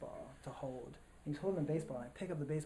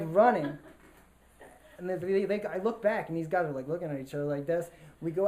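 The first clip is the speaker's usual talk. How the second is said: rising, louder, breathless rush; excited; 4 semitones higher, 7 dB louder than usual. Real, not acted. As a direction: tell it like a race against time. Rushing dad out of hospital.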